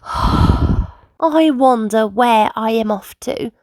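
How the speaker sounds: slow, dreamy musing; wondering softly. A narrator's reading. Old bus musing after a sigh of relief, slow and dreamy. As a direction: wonder aloud slowly to yourself. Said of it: "he thought to himself".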